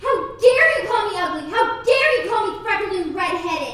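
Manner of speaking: furious outburst. Acted. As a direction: hurl it back at the insult. Girl insulted about her looks.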